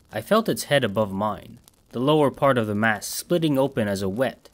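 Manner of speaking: ordinary delivery, nothing marked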